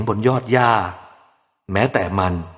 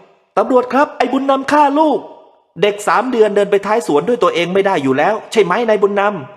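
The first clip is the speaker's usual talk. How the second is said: much higher, excited denunciation; accusing voices; 11 semitones higher, 3 dB louder than usual. A narrator's reading. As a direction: call out the crime. Imagined accusers and police.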